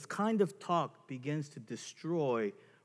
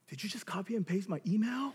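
higher-pitched mock indignant question; imitated incredulous couple; 6 semitones higher, faster than usual. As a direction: mimic a spouse catching you out. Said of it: "Some of our couples right now are going".

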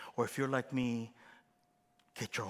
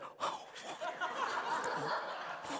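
high breathy laugh; mock audience laugh; 5 dB quieter than usual. Pastor imitates the crowd's charmed high laugh. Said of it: "all of you are like".

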